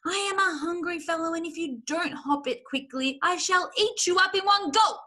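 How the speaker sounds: high, rising to loud menace; menacing threat. A narrator's reading. Fox warning Rat he will eat him, menacing. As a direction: build to a relished threat.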